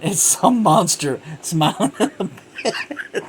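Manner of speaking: laughing through the words; high, loud, amused telling. Real, not acted. Laughing recalling the jump scare.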